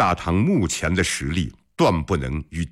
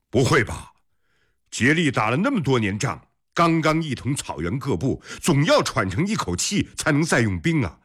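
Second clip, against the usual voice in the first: higher-pitched, doubting objection; puzzled disbelief; 6 semitones higher than usual. A narrator's reading. General doubting the emperor's warning of attack, puzzled. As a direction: argue back in raised-pitch bafflement. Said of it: "尉迟敬德一脸疑惑".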